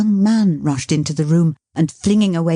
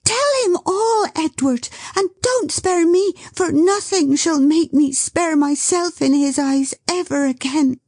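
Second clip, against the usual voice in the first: high, earnest urgent plea; 12 semitones higher than usual. Acted. Dot insisting Edward tell everything, urgent high plea. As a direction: spare nothing. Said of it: "cried Dot".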